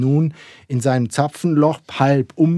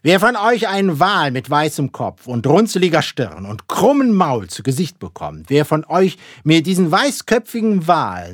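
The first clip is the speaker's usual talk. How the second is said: loud, high proclamation to the crew; captain's booming announcement; ringing raised voice; 5 semitones higher, 6 dB louder than usual. A narrator's reading. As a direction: call it out resoundingly across the deck. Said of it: "mit schallend erhobener Stimme rief er".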